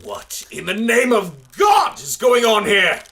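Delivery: furious bellow; furious shout. Acted. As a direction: burst in and demand answers. Father bursts in shouting.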